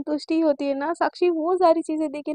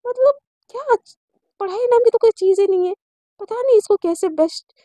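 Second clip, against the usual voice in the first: higher and louder grumbling disbelief; mild exasperation; 6 semitones higher, 6 dB louder than usual. Acted. Sunaina complains inwardly about the student, mildly exasperated. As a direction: let the voice lift, a touch fed up.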